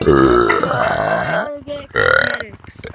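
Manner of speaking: words burped out slow and low; burping the words. Real, not acted. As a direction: talk through a long burp. Voice chat, talking through burp.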